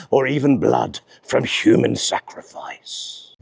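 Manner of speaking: low, ominous storyteller voice; darkly dramatic, sinking low at the end; spooky voice. Pretend. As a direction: drop into a hammed-up sinister tone. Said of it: "said it in that voice".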